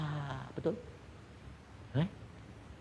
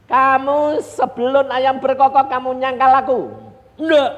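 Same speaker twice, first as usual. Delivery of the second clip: loud retold exchange; 11 semitones higher, 13 dB louder than usual. Jesus and Peter exchange.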